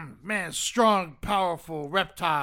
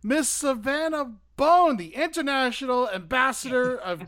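host announcement; 5 semitones higher than usual. Acted. Announces guest again, bigger.

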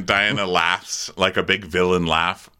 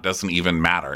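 ordinary delivery

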